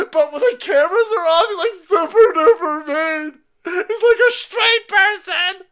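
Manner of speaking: very high, loud, shrieking mock sobs; mock panicked woman. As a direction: ham up a hysterical woman about to be fired. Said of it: "She's freaking out".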